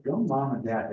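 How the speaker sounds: ordinary talk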